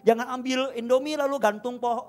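loud, high exhortation; full-force preaching. Real, not acted. Preacher urging the church to trust God, at full force. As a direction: preach it hard to the congregation.

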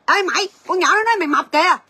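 mock gossip stirring trouble; provoking loud tone. Shown as a demonstration. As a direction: shout it to pick a quarrel. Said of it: "nói cái giọng điệu thôi".

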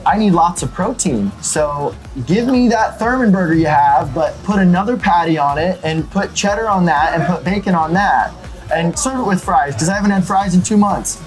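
ordinary talk

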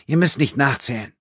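slightly sullen. A narrator's reading. Thief to the man she robbed, slightly sullen. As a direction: pitch it up, obstinate and defensive. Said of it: "Immer noch klang sie leicht verstockt".

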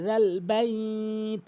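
slow chant, long বাইত; "dhal baiit", drawn out; correct dhal. Shown as a demonstration. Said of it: "জিব্বার আগে দাঁতের আগে".

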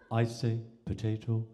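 posh English, low and even; posh English accent. Acted. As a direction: play a very posh English gentleman. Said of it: "a very posh Englishman".

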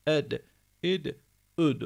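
"Ed, id, üd" with a bounce after each D; qalqalah echo. Shown as a demonstration. Teacher drilling students, echoed "Ed, id, üd". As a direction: release each final D into a short echoed vowel. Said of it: "Bunda da kalkale var".

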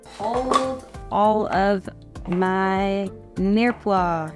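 normal talking voice

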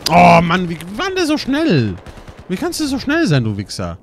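loud, high outraged yell; frustrated yell. Real, not acted. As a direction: yell at the enemy who was too fast. Killed by fast enemy. (wütender, hoher Ausruf über den schnellen Gegner)

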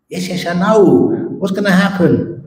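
can barely wait for the answer; an overexcited man begging to hear his future; eager excitement. Retold or imitated.

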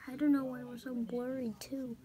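ordinary talk

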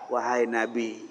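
usual speaking voice

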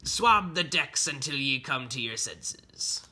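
slow, stern pirate order; pirate captain. Acted. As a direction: hand down the punishment slowly, as a pirate. Pirate captain punishing his son.

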